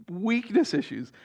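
ordinary delivery

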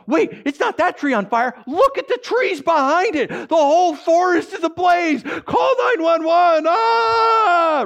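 high, slow shouting building to a scream; acted panic. Seeing the whole forest ablaze, acted panic.

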